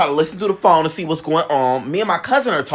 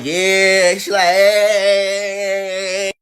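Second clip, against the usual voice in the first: drawn-out greeting; much slower than usual. Retold or imitated. Imitating Keisha's entrance greeting.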